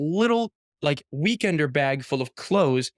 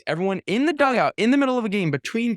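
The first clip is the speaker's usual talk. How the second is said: excited; 8 semitones higher than usual. Real, not acted. Excited retelling of call-up.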